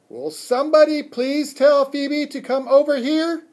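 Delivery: high, very loud pleading shriek; sustained high yell, exasperated plea. A narrator's reading. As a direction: shriek for help at the whole room. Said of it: "I shrieked".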